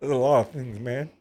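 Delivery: choked up with a laugh; real tearful. Real, not acted. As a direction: laugh through the tears as you answer. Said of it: "wasn't supposed to make you cry".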